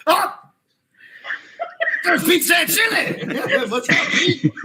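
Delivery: high laughing shout. Real, not acted. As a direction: burst out in a laughing yell.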